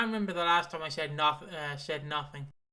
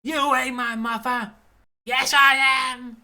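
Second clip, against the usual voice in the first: higher, louder, slow playful shout; mock mother's retort; 7 semitones higher, 10 dB louder, slower than usual. Reading a fan's post, acting the mother's reply loudly. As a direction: belt it out like a mock soap row. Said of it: "one we do together is".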